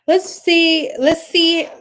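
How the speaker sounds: slushy S sounds in "Let's see"; lisp. Real, not acted. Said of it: "Gosh, I got a lisp".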